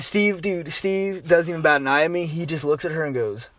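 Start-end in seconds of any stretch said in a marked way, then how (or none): none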